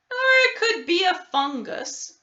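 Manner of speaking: high, loud character voice; high doctor voice. A narrator's reading. Doctor Gord adds a diagnosis, voiced high and loud. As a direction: pop it up high and bright.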